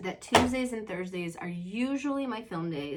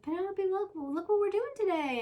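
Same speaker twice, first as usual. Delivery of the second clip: much higher, sing-song teacher voice; cheerful teacher; 12 semitones higher, 3 dB louder than usual. Retold or imitated. Teacher cheering up Penelope at drop-off, high sing-song. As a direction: coax a child like a kindergarten teacher. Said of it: "the teacher tries to help".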